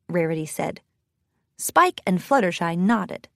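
usual speaking voice

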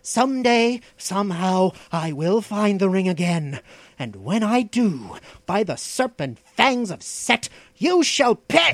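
vengeful oath building to a high, loud peak; hateful vow. A narrator's reading. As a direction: swear revenge, climbing to a furious cry. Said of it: "The fiendish hatred of the Stygian".

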